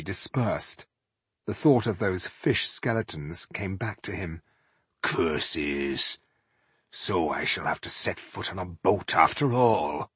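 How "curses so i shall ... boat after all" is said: low, softer dwarf grumble; grumbling dwarf. Acted. Dwarf facing a boat trip after all, grumbling. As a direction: curse your luck low and resigned.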